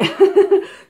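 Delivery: loud high burst of laughter; a real amused laugh. Real, not acted. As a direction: laugh at yourself. Joking about night filming.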